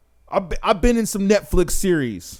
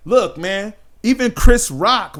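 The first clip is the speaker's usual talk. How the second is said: ordinary talk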